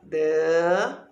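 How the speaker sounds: dama with a long gap, da... ma; syllable by syllable. Shown as a demonstration. Teacher writing it in the chart, syllable by syllable. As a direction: dictate dama slowly in two separate syllables. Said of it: "eu vou colocar aqui".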